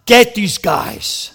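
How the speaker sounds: a loud "God", then a slow "get these guys"; Nehemiah's curt prayer, hammed up; mock blunt prayer. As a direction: pray it like a grudge. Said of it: "He said".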